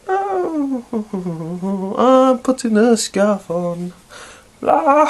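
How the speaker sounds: silly high voice. Pretend. Comic voice putting scarf on.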